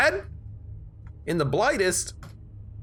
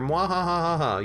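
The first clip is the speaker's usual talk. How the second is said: evil laugh; 6 semitones higher than usual. Acted.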